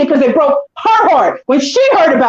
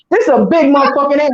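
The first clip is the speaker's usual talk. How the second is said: ordinary talk, nothing marked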